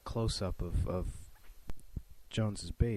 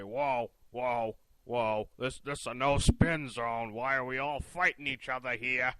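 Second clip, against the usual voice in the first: lightly voiced, a bit higher and louder pundit; Bill O'Reilly impression; 3 semitones higher, 5 dB louder than usual. To the other pundits, a mild mock O'Reilly plea. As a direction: mildly mimic a TV pundit calming the room. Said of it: "I don't know why I just sounded like, like that".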